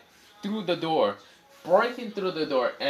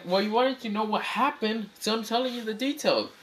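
put-on high girl voice; 10 semitones higher than usual. Suzuki voiced matter-of-fact.

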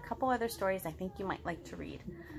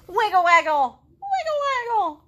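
playful wiggle call; 9 semitones higher, 9 dB louder than usual. Acted.

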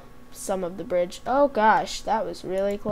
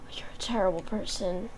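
mock offended whisper; 8 dB quieter than usual. Friend says wouldn't miss him.

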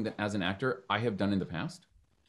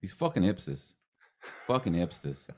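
a lower New York accent demo, spitting out "fucking hipsters" as "fuckin' hipstas"; 4 semitones lower, 5 dB quieter than usual. Shown as a demonstration. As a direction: grumble "fucking hipsters" like a fed-up New Yorker, dropping the r. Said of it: "that's how you do it".

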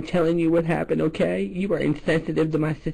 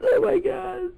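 hammed-up crying; 11 semitones higher, slower than usual. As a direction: wail it like overdone fake pain. Caller fakes pain and crying.

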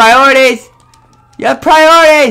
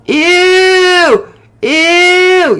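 disgusted; 6 semitones higher than usual. Real, not acted. Disgust reaction.

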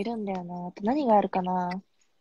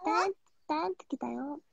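cute little sister voice; 5 semitones higher, 6 dB quieter, slower than usual. Pretend. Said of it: "言ってあげて".

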